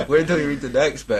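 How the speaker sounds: bursts of loud laughter; laughing. Real, not acted. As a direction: laugh out loud through the words.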